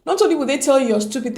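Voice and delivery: ordinary talk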